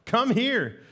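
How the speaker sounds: loud, high call into the furnace; king calling them out. Retold or imitated. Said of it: "He says".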